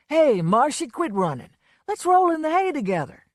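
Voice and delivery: put-on a loud leering drunken shout; drunken call. A narrator's reading. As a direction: holler down the hall at her. Chasing her down the hall.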